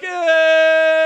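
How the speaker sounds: put-on high, loud little-kid whimper; dismayed kid groan. Kid hears nothing can be done, high dismayed whimper. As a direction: squeak out childish dismay.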